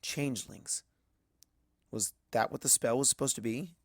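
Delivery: usual speaking voice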